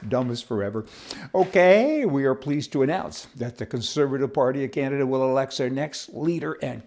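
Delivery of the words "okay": loud okay. Real, not acted. Loud reset after laughing.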